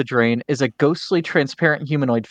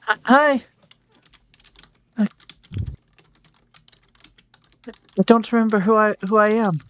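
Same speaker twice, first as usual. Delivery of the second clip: high, lost ghost voice; 8 semitones higher than usual. Acted. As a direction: speak like a confused spirit.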